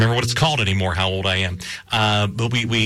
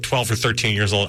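usual speaking voice

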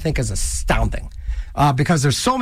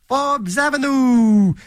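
dramatic promo announcer; 6 semitones higher, 3 dB louder, slower than usual. Acted. Announcing the show name dramatically.

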